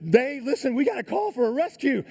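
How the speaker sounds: very high, loud urgent plea; panicked insistence. Retold or imitated. Climber to doctor on speakerphone, frantic and insistent. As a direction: act out frantic demand for help.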